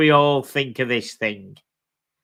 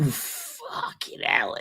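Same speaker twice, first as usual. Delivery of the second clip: whispered disbelief; 7 dB quieter, much slower than usual. Real, not acted. Shocked at watch price.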